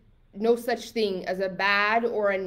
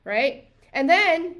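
usual speaking voice

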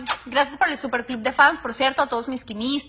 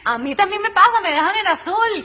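half-joking indignant rant, louder and higher; mock outrage; 7 semitones higher, 7 dB louder than usual. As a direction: play up being offended at being left on read. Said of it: "me indigna". (indignación exagerada en broma, más alta y fuerte)

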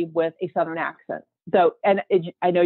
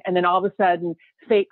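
usual speaking voice

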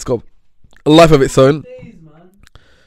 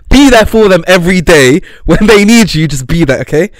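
louder, pressing advice to the audience; earnest loud advice; 3 semitones higher, 5 dB louder than usual. Shown as a demonstration. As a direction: urge it like a big brother. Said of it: "If you have a friend".

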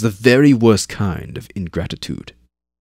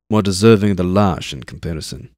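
ordinary delivery, nothing marked

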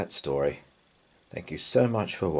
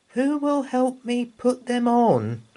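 put-on high, small child's voice; little girl Anna; 4 dB louder than usual. A narrator's reading. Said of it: "said Anna".

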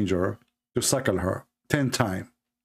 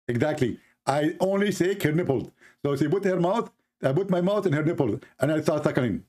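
higher mock preacher voice; Zakir Naik impression; 5 semitones higher than usual. Host as preacher explaining, voice pitched higher. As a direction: chirp it proudly as the preacher. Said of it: "So, Zakir".

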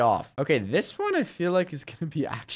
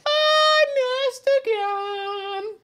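very high, drawn-out whine; frustrated wail; much slower than usual. Real, not acted. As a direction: wail slowly over another miss. Wailing over another miss.

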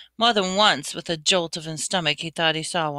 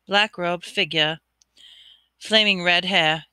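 ordinary delivery, nothing marked

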